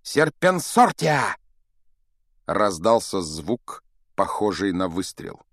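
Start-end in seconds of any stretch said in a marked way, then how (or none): 0.0-1.4 high, slow, drawn-out incantation; shouted spell. A narrator's reading. Boy casting a snake spell at his rival, drawn-out cry. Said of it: "мгновенно взмахнул палочкой и крикнул".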